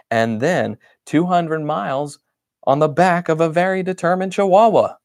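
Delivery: a sarcastic, worn-out complaint; exasperated. Acted. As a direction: grumble it out in one exasperated rush. Grumbling diary entry.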